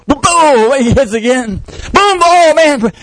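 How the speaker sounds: high excited punch sounds; boxing blows. Retold or imitated. Preacher acting out punches, high excited blows.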